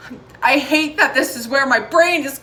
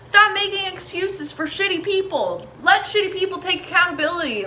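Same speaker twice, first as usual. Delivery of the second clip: "Stop making excuses for shitty people" pitched much higher with rising heat; getting angry; 7 semitones higher than usual. Real, not acted. Reader scolding viewers who excuse bad people, heated. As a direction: let the anger push the words up. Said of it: "I'm getting angry now".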